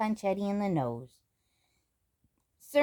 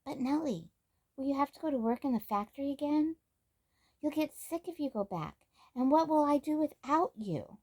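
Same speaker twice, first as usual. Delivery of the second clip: higher, anxious pleading; 7 semitones higher than usual. Acted. Samantha pleads with leaving Nellie, higher and anxious. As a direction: beg her friend not to go.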